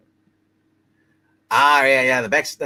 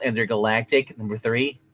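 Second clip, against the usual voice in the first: ordinary delivery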